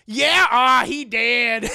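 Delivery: loud comic bluntness; imitated blunt verdict. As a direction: belt out the bad news comically.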